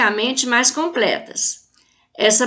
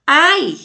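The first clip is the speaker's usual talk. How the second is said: short pained ai cry; ouch; 4 semitones higher, 5 dB louder than usual. Shown as a demonstration. Teacher demonstrating an interjection, a pained yelp. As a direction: yelp it like a sudden hurt. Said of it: "Ai é até uma interjeição".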